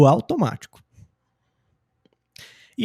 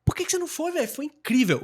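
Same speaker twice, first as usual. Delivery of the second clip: high-pitched "Por que que você não foi", falling on "véi"; Portuguese intonation model; 10 semitones higher, 4 dB quieter than usual. Shown as a demonstration. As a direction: lift the question high and softer, "incrível" high then falling. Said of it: "Vou aplicar a mesma entonação".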